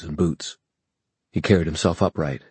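plain reading voice

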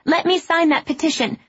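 loud, high, eager call. A narrator's reading. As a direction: shout it over the crowd.

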